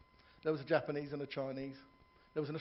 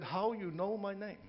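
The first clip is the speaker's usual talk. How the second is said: put-on Chinese accent; 4 semitones higher, 3 dB quieter, slower than usual. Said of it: "little Chinese waiter".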